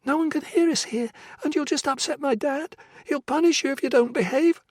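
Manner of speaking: put-on high, childlike girl warning calmly; a small girl matter-of-factly warning about her dad; young girl's voice. Girl in the cellar warns Jessica about her father: high childlike voice.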